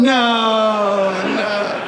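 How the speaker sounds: high, loud, far-off wail of a doomed calf; comic squeal of a calf seeing its fate; panicked calf. Acted. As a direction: beg for your life as the fatted calf. Calf dreading the feast.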